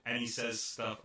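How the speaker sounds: ordinary talk, nothing marked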